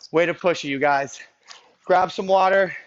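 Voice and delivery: normal talking voice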